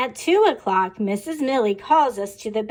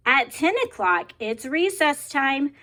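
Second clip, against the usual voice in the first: plain reading voice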